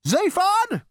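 put-on high, scornful old man; ghostly great-grandfather scolding; crotchety old ghost. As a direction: sneer down at the useless great-grandson. Ghost speaking.

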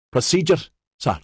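puffing. A narrator's reading. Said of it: "Maxon puffed".

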